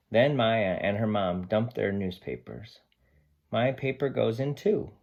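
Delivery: lively picture-book read-aloud; reading to children. Acted. Teacher reading Sam the dog's story to her class, lively and expressive.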